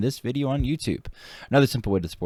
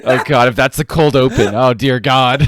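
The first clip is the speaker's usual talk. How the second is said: loud laughing oh God; laughing exasperation; 5 semitones higher, 11 dB louder, slower than usual. Real, not acted. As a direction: crack up in mock despair. Reacting to embarrassing cold open.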